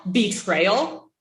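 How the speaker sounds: ordinary delivery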